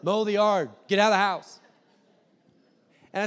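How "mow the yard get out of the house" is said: louder, firm repeated order; no arguments, just go; stubborn mom. Retold or imitated.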